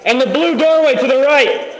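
calling out. Real, not acted. Shouting directions to a teammate.

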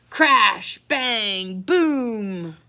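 each word boomed out and drawn long; loud crash words. Acted. Storybook crash noises acted out.

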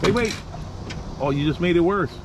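normal talking voice

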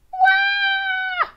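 put-on high shouted baby wah; baby wail. Baby crying in the house, high wail.

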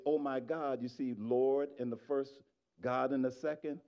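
ordinary talk, nothing marked

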